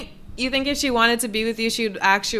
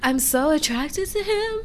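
higher, dragged-out fake swoon; sarcastic mimic of her gushing; mocking Mallory; 8 semitones higher than usual. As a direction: parody her unconvincing crush. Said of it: "She's like".

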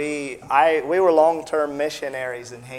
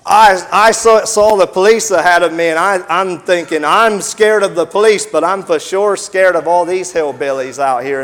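louder, higher, urgent retelling; excited telling; 4 semitones higher, 9 dB louder than usual. Real, not acted. Missionary reliving the escape, tense and loud. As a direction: build the tension of running to the police. Fear meeting police and locals.

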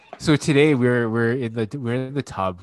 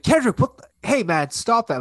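put-on raised, high scolding of a child; retold yell; 12 semitones higher, 5 dB louder than usual. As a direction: yell at your son to stop, re-enacted. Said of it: "I yelled at him".

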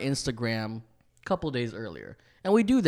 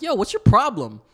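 louder, higher confrontational demand; retold pissed-off challenge; 7 semitones higher, 8 dB louder than usual. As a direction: call him out, re-enacting your anger. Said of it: "at this point, I'm pissed".

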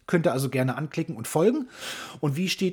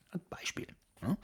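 whispered; 14 dB quieter than usual. Real, not acted. Aside after the mock lesson.

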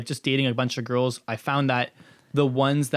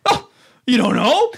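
mock conspiracy guy; 10 semitones higher, 7 dB louder than usual. Conspiracy guy reacting, laughing retelling.

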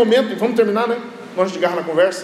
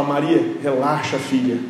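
low, gently acted calm; soothing reassurance; 6 semitones lower, slower than usual. Preacher as Jesus to distressed Mary, low soothing voice. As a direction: settle her down in a lowered voice.

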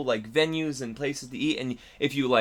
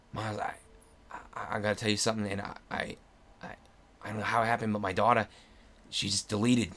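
hesitant confession; 4 semitones lower, 5 dB quieter than usual. Retold or imitated. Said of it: "he gets back to me and he's like".

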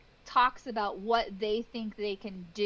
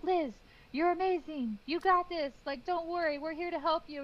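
reassuring; 7 semitones higher than usual. Retold or imitated. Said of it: "they'd be like".

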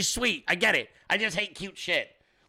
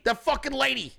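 normal talking voice

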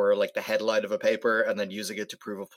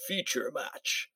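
slow, higher, softened robot announcer; robotic announcer voice; 4 semitones higher, 7 dB quieter, much slower than usual. Acted. Robot announcing the start of a round, robotic voice. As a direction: announce it like a game-show robot, drawn out. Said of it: "Just a robotic voice".

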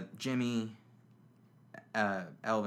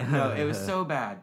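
normal talking voice

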